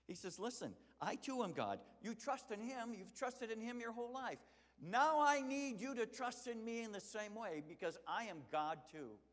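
loud, high impassioned preaching. Acted. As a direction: preach it out with full fire. Preaching at full force.

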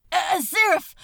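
a sudden high, loud cry for him; desperate cry. A narrator's reading. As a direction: call out his name in panic. Crowley cries out being dragged.

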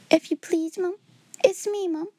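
high, timid maid voice; a frightened little servant girl pleading; Becky's voice. A narrator's reading. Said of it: "she explained".

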